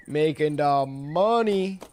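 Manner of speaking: slow, stretched put-on American drawl; hammed-up American accent; mock American accent. Repeats mock American accent bit.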